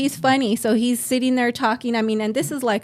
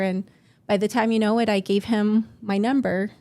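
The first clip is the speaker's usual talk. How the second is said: ordinary talk, nothing marked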